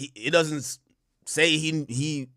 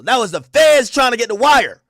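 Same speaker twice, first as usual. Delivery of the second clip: usual speaking voice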